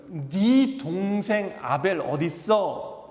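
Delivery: slow, searching question from God; God questioning Cain. Retold or imitated. God asking Cain where his brother is, slow and probing. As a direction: ask it slowly, like God probing a guilty son. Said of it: "이때도 뭐래요?".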